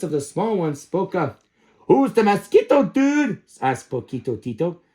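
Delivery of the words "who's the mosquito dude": high, loud little runt; tiny dog voice. Acted. Smallest chihuahua pipes up, high and loud. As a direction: pipe up high and cheeky.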